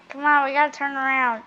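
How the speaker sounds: ordinary delivery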